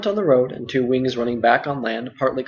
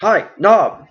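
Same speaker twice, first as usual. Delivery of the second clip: calling out louder across the inn; shouted; 6 semitones higher, 6 dB louder than usual. Acted. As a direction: yell for the servant boy. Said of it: "he shouted".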